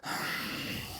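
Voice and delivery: long unvoiced exhale to calm down; deep calming sigh. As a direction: breathe out the tension. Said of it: "they're like".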